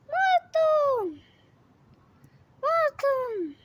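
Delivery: high, loud call across the garden. Real, not acted. Calling the dog Morton, loud high call. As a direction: call the dog from far away.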